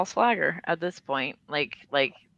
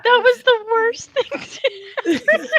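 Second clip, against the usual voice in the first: high, slow, squealing through laughter; mortified at her own joke, crying with laughter; 5 dB louder, slower than usual. Real, not acted. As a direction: laugh so hard the words barely come out. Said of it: "I'm just crying".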